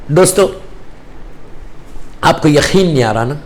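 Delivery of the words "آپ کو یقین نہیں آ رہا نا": ordinary delivery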